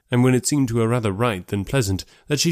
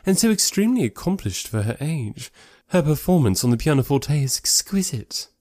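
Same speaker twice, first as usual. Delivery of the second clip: higher-pitched, gushing society lady; Miss Bingley; 5 semitones higher than usual. Acted. Lady raving about Miss Darcy's accomplishments, gushing.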